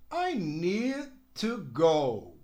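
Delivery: I need to go said slowly and clearly; teacher demo of I need to go; slow model sentence. Shown as a demonstration. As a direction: model each word.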